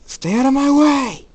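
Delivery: high, loud, sustained yell; angry shout. Acted. Jacob warns Michael off mid-fight, shouting angrily. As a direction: bellow a warning at whoever blocks you.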